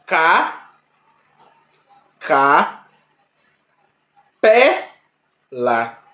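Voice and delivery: teacher spelling out syllable stress. Shown as a demonstration. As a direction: split the word into syllables, punch pé. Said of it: "a separação silábica".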